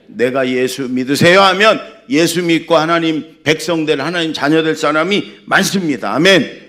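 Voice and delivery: loud, high-pitched proclamation; full-force preaching. Acted. Preacher declares scripture to congregation, full-force preaching.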